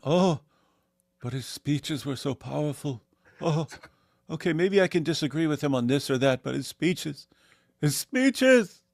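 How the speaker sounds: mock fawning over the speeches, rising to a squeal; mocked fawning apologist. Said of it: "A P's always the same".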